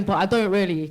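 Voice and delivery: ordinary talk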